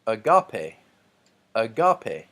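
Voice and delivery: "agape" with GA punched loud, a-GA-pe; stress on second syllable. Shown as a demonstration. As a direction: hit the middle syllable of "agape" hard. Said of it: "is over the second alpha".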